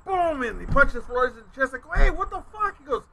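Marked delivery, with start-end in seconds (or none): 2.0-2.8 high-pitched mock outrage; retold startled protest. Storyteller quoting reaction to friend's chest punch, high startled protest. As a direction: jump up in pitch, acting taken aback.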